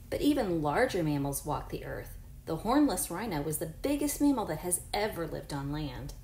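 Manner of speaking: clear, even read-aloud voice for a class; reading to children. Acted. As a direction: read the book to your pupils. Reads book aloud to class.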